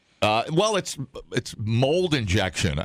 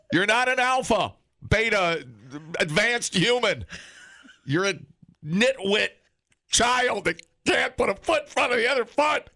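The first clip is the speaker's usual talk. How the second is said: mock rant; 11 semitones higher than usual. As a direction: rail at the kids. Ranting at young generation.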